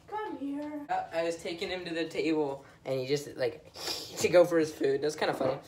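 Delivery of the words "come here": soft, high coaxing; small high voice for an animal; pet talk. Real, not acted. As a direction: call the cat over softly and high. Talking to the cat.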